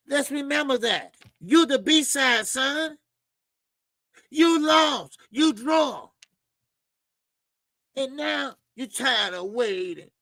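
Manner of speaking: high-pitched teasing put-down; mock taunt. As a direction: rub it in with a high, playful voice. Taunts the fighter as B side.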